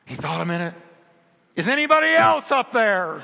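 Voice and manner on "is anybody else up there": high, loud comic shout; yelling. Retold or imitated. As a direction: yell past the answer for someone else. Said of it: "he starts yelling".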